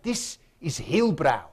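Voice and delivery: slow, stern warning in a bad Afrikaans accent. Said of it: "do the Afrikaans accent really badly".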